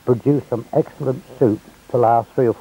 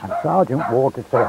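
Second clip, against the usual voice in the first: normal talking voice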